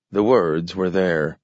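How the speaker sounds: usual speaking voice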